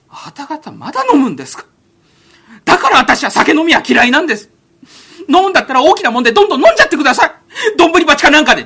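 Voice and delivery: a sheltered heir lecturing the drinkers; indignant young master. Acted. Young master scolds his companions about drinking, indignant.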